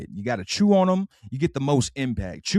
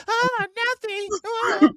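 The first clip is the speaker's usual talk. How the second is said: very high, tiny timid squeak; scared baby; much slower than usual. Acted. Baby backing down from his brother, tiny high squeak. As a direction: squeak it out like a cowed toddler. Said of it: "He was like".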